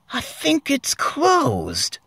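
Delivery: high falsetto, slow; squeaky slow noticing; Ron's voice. A narrator's reading. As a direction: dim high falsetto observation. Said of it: "he noticed".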